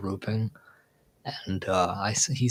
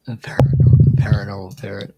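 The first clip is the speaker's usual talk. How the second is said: loud laughing burst on the name; cracking up as it comes out; 11 dB louder than usual. Real, not acted. Mocking the nickname.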